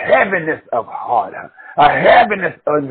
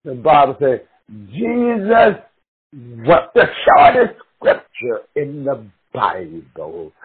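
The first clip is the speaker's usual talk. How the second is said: ordinary talk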